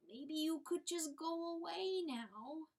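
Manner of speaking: high, slow, close to crying; near tears. Acted. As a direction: hold back the tears as you beg. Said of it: "She was near tears".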